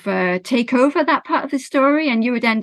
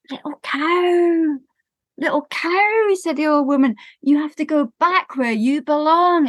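put-on higher, slower old woman's plea; 6 semitones higher than usual. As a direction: coax the cow home like an old lady. Old woman speaks to cow.

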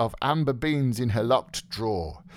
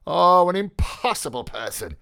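high, loud burst of exasperation; exasperated exclamation; 10 semitones higher, 6 dB louder than usual. A narrator's reading. Mulligan giving up on touchy Stephen, exclaiming. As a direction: throw up the voice in despair at him. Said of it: "he exclaimed".

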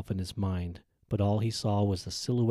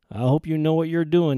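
raised, higher warning to his partner; louder warning; 8 semitones higher, 9 dB louder than usual. A narrator's reading. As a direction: push it out with alarm. Partner scolded under pressure.

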